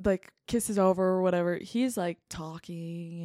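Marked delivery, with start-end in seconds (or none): 2.3-3.2 low, very soft voice; hushed hint. Real, not acted. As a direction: drop the word to a murmur. Hinting at his dirty talk.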